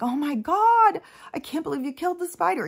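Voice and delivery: high, loud shocked cry; horrified at the spider's death; high shocked outcry; aghast. Retold or imitated. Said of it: "Everybody's like".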